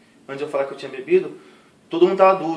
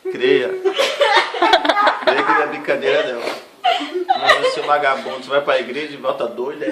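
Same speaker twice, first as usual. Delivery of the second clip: mocking mother; 6 dB louder than usual. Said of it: "minha mãe falou assim".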